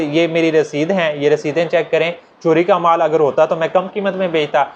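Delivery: ordinary delivery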